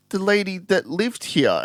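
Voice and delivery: put-on high, slow toddler voice; a small child stating it simply; little girl voice. Little sister tells them about the lady, high and slow.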